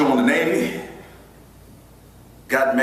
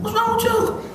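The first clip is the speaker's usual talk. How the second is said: high, laughing voice; wife's puzzled question. Retold or imitated. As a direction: ask it high while still laughing. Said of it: "my wife is like".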